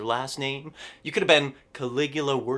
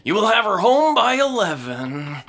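stern father voice; 5 semitones higher, 7 dB louder than usual. Acted. Playing the stern dad.

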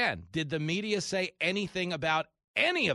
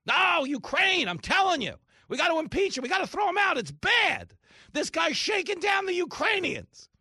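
much higher, frantic shouting; hysterical media; 13 semitones higher, 4 dB louder than usual. Retold or imitated. Host mocking impeachment hysteria, shrill. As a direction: panic like a pundit demanding impeachment. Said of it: "What was the impeachment for Ukraine?".

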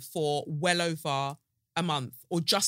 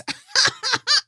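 loud high laugh; 10 dB louder than usual. Real, not acted. Host cracking up at her own meme line, loud cackle.

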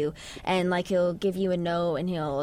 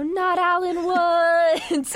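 hammed-up cry, much higher and louder, stretched slow; mock dramatic outcry; 11 semitones higher, 6 dB louder, much slower than usual. Cast reacting on set to news about Alan Woods, mock dramatic cry. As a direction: wail it like a melodrama. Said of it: "just be really dramatic about it".

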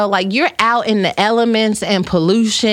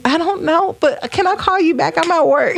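전화를 급히 끊으려는 말투; dodging a caller; 7 semitones higher than usual. Pretend. Pretend phone call, brushing off.

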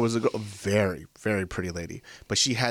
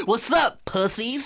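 mocking fair-goer; 11 semitones higher, slower than usual. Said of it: "They called us pussies when we walked in".

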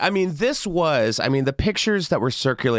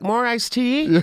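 mock waiter; 10 semitones higher, slower than usual. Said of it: "And I'm like".

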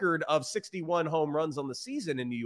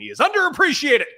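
emphatic; 11 semitones higher, 10 dB louder than usual. Real, not acted. Praising co-host emphatically.

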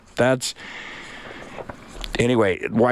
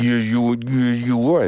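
put-on baby talk. Shown as a demonstration. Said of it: "you know, baby talk".

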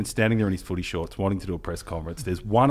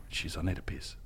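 softer, half under his breath; muttered to himself; 6 semitones lower, 12 dB quieter than usual. Retold or imitated. Reporter at the gate, muttering to himself under his breath. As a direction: mutter it like a private grumble. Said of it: "and I'm like".

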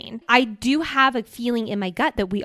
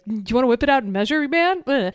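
lightly voiced bro, quick and higher; slightly hammed-up macho dare; mock cocky rider; 3 semitones higher, 4 dB louder, faster than usual. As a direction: rattle off a mock bro challenge. Mocking riders kicking back.

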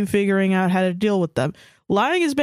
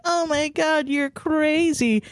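high, drawn-out gossipy gushing; mock gossip reaction; 8 semitones higher, slower than usual. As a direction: imitate a coworker egging on gossip, slowly. Imitates gossips reacting to each other.

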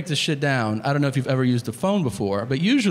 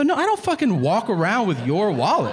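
high, indignant outburst; exasperated; 6 semitones higher than usual. Retold or imitated. Diner answers waiter's absurd wallet question, exasperated. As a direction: swing the pitch up in disbelief.

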